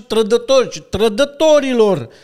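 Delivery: a retold colonel bawling out traitors; colonel yelling. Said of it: "strigă la ei".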